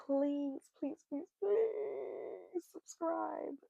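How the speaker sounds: mock begging for subscribers, high and slow; playful begging. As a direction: beg them, stretching each please. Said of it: "I'm not begging".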